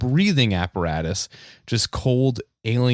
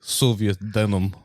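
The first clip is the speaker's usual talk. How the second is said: mock Russian accent; 2 semitones lower than usual. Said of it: "talk in a Russian accent".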